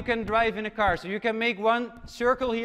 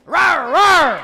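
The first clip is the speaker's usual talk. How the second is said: roaring; 6 semitones higher, 12 dB louder than usual. Acted. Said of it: "Okay, repeat".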